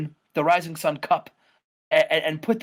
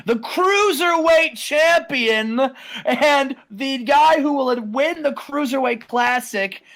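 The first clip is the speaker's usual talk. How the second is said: arrogant boast; 6 semitones higher, slower than usual. Acted. Matt boasting to his brother, high and slow. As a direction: announce yourself like a champion.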